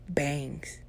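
ordinary talk

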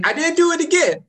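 indignant protest. Real, not acted. Protests accusation.

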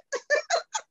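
a high, amused laugh; real high laugh. Real, not acted. Laugh after incredulity.

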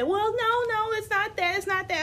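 high-pitched backpedalling no; high defensive principal. Retold or imitated. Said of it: "And she said".

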